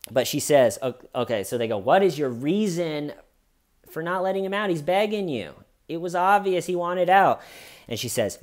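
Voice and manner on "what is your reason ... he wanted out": higher-pitched imitation of pressing questioners; retold challenge. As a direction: press for an answer, lightly acted. Detectives confront suspect about her reason.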